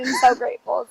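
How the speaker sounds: ordinary talk, nothing marked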